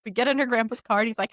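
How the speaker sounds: normal talking voice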